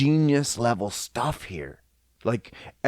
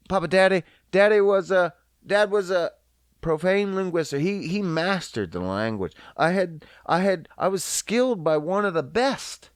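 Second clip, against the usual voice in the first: ordinary talk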